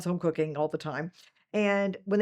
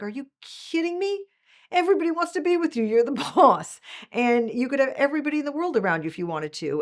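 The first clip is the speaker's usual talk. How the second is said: much higher, louder incredulous outburst; outraged disbelief; 9 semitones higher, 6 dB louder than usual. Retold or imitated. Italian CEO scoffing at lonely at the top, high outraged disbelief. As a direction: scoff at the very idea, voice pitched way up. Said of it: "he was just outraged by it".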